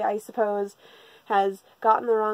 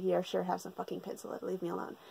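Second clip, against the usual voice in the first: quick, low-energy mock dismissal, much softer; dismissive brush-off; 3 semitones lower, 12 dB quieter, faster than usual. Girl waving off the classmate asking for pencil lead, dismissive. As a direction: mumble it away like you want to be left alone.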